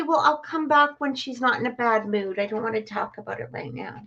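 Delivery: normal talking voice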